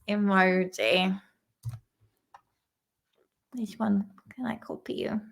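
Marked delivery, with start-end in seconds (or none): 3.5-5.2 whispering. Real, not acted. Aside while phone talks.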